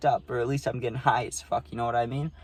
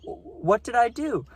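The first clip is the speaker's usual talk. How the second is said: mock-innocent self; 10 semitones higher than usual. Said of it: "And I was like".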